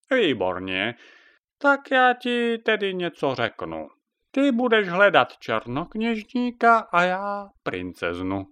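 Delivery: a witch bossing her helper about; witch Jaga. Acted. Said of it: "Jaga proposes a plan".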